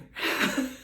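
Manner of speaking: airy giggle of excitement; breathy excited laugh. Real, not acted. Giggling while recalling feeling her baby's head. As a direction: laugh it out in disbelief.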